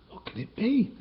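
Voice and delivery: squeaky, high anxious question; worried question. Acted. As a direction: ask it high and nervous. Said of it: "he asked".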